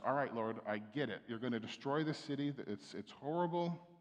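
ordinary talk, nothing marked

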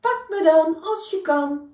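a shrew daring the bear to catch him. Acted. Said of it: "riep Siem".

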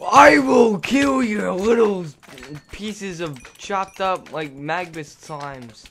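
slow, high, loud ranting threat; raging gamer. Pretend. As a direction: roar the catchphrase slowly. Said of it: "Doesn't have the same ring to it".

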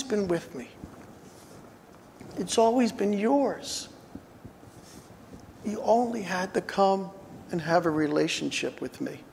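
soft, tender father speaking to his son; endearing father. Acted. Father in the parable reassuring his elder son, tender. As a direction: coax him gently, like a loving dad. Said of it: "Here the father is endearing".

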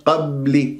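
"قبل" halted at closed lips on b; stopped on the b. Shown as a demonstration. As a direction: freeze the b in "قبل" without releasing it. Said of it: "شوف وقفت على المخرج".

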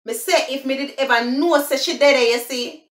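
quick Jamaican patois line. Shown as a demonstration. Teaching viewers Jamaican patois phrases, in patois. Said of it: "that's the broken English".

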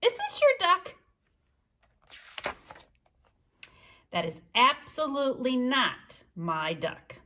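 lively read-aloud with high character voices; storytime reading. A narrator's reading. Librarian reading to children, animated character voices. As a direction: read the duck story to the kids, bouncing between voices. Reads picture book with voices.

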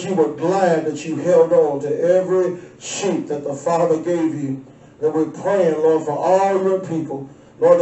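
low, steady prayer voice; closing prayer. Acted.

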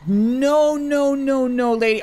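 long high NO tapering down; loud mock refusal. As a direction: cut her off with drawn-out noes. Refusing an in-game traveller.

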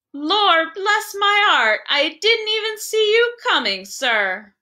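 high, slow surprised working man; a workman bumped into, startled and apologetic. A narrator's reading. Working man after collision.

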